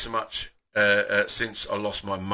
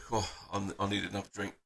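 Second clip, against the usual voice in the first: much softer, under his breath; quiet aside to himself; muttered aside; 2 semitones lower, 12 dB quieter, faster than usual. Real, not acted. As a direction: mutter it to yourself. Muttered aside after emotion.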